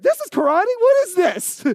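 high, loud indignant protest; mock angry outburst. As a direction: play the fed-up student blowing up. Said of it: "Then he gets really mad".

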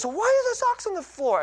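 high-pitched mock nagging; imitating scolding wife. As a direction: play the wife demanding an answer, voice way up. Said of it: "and then she goes".

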